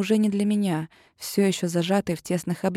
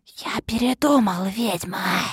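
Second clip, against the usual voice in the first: whisper; slower than usual. A narrator's reading. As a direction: hiss it close to her ear. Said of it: "вдруг прошептал похититель". (зловещий шёпот)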